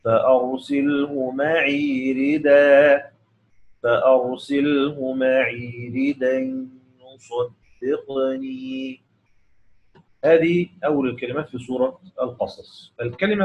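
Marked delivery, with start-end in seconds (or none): 0.0-9.0 slow recitation, ردا stopped on a long alif "radaa"; Quranic recitation. Shown as a demonstration. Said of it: "تنوين بالفتح ويقف عليه بألف العوض".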